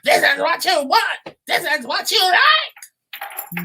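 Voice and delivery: very high, slow mock accusation; mock accusing husband. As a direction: drag it out like an accusation.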